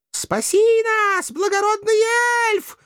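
very high, slow damsel wail; pleading. A narrator's reading. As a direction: beg for rescue in a thin maiden voice. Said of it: "взмолились мокрохвостки".

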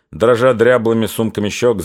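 usual speaking voice

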